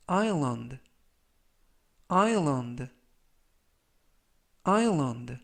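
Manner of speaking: island said as eye-land, s silent. Shown as a demonstration. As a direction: pronounce island with no s, stress on I. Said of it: "S가 묵음이라서".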